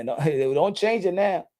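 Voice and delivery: usual speaking voice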